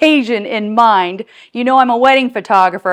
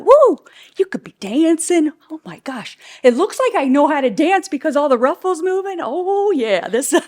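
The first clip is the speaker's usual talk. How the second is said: high whooping, giddy excitement; playfully obnoxious; 6 semitones higher than usual. Real, not acted. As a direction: whoop and gush like you're dancing along. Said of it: "if I'm too obnoxious".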